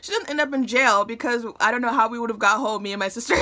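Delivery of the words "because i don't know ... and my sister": usual speaking voice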